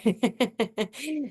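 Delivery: high, rippling giggle. Real, not acted. Host amused by her own advice to creators, giggle. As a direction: giggle at your own remark.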